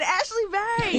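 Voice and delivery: high, loud excited greeting. Retold or imitated. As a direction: call out her name excitedly. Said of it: "you said".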